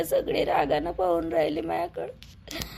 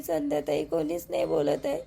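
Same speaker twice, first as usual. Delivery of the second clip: tearful; 8 semitones lower than usual. Acted. Wife complains nobody talks to her.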